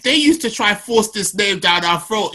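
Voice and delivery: normal talking voice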